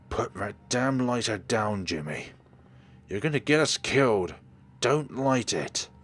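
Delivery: loud, urgent yelling, dragging out the words; frantic shout. Acted. Shouting at Jimmy to put the lighter down, frantic. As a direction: yell at him to stop before it blows.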